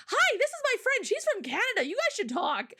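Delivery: high, bright matchmaking voice; eager introduction. Retold or imitated. Her Korean friend introducing her to a stranger, eager. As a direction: introduce them with keen enthusiasm.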